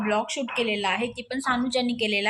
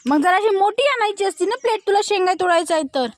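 teasing; 7 semitones higher, 5 dB louder than usual. Real, not acted. Mother teasing toddler.

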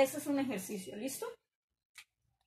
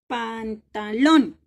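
Pantalón very slow, final LÓN much louder; stress on LÓN; 12 dB louder, much slower than usual. Shown as a demonstration. Teacher revealing the tonic syllable, Pantalón stressed hard on LÓN. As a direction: stretch Pantalón and slam the last syllable LÓN. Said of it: "En lon".

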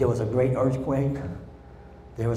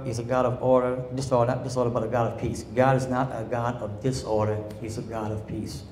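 normal talking voice

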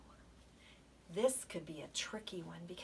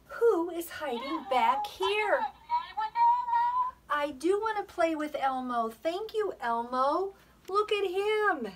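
excited; 9 semitones higher, 6 dB louder than usual. Real, not acted. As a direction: gush with delight over the toy. Excited discovery of Elmo toy.

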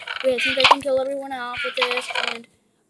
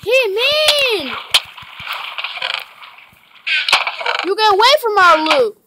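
yelling at enemy; 5 semitones higher than usual. Real, not acted. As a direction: shout them off the loot.

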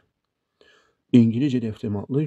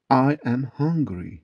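"I am hungry" slowly, word by word; slow teaching model; slower than usual. Shown as a demonstration. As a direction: spell out "I am hungry" slowly for learners. Teacher gives slow full form first. ("I am hungry" yavaş, tane tane)

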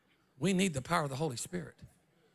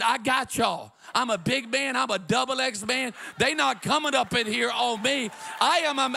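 reassuring boast; 7 semitones higher, 5 dB louder than usual. Retold or imitated. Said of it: "and I said".

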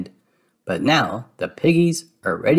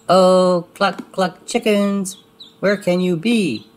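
high, drawn-out plaintive call; Clarabelle calling; 7 semitones higher, slower than usual. Acted. Clarabelle calls out searching for her chickens. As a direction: call out for the lost hens. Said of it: "she cries".

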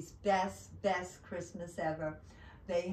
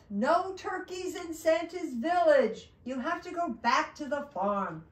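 loud, high elf ordering someone out; elf's cry; 7 semitones higher, 7 dB louder than usual. Acted. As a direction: call out the rule forcefully. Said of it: "cried the elf".

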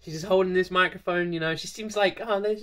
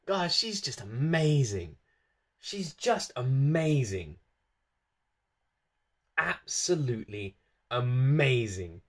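awed hush; 5 semitones lower, 6 dB quieter, slower than usual. Real, not acted. Awed after the song.

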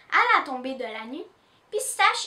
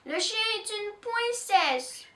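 crying; 7 semitones higher, slower than usual. Acted. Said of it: "pleure Pauline".

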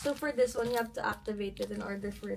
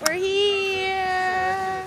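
high excited call; excited shout; 9 semitones higher, 11 dB louder than usual. Real, not acted. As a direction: shout it out with joy. Arriving excited at ski slope.

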